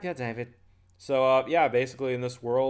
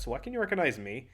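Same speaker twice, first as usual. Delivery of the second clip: ordinary talk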